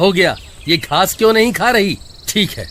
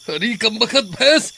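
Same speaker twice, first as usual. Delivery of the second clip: usual speaking voice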